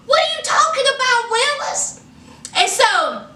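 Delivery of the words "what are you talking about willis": high, fast incredulous; mock disbelief; exasperated catchphrase. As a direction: toss it off like a sitcom line.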